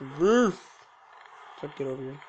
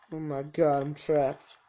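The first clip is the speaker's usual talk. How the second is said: ordinary talk, nothing marked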